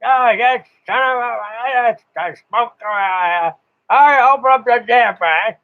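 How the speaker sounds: high, rising mock exasperation at the smoke; imitating annoyed operator. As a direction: play the fumbling old guy cursing the stove. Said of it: "Smoke rolls out".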